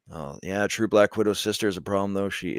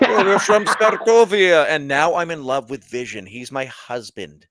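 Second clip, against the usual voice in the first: lightly mocking Eastern European accent; mock Sokovian accent; 4 semitones higher, 6 dB louder than usual. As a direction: parody Scarlet Witch's fading Eastern European accent. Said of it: "she hasn't lost her accent like".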